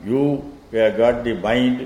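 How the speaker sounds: ordinary talk, nothing marked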